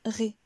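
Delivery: RÉ with the sound going down; falling tune. Shown as a demonstration. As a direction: let ré drop as the last syllable. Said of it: "Le son descend".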